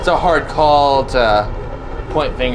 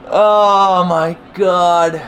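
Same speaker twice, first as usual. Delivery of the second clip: laughing disbelief; 5 semitones higher, much slower than usual. Real, not acted. Laughs after forced repair.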